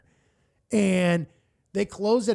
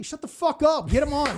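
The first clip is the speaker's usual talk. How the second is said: impatient crowd; 9 semitones higher than usual. Retold or imitated.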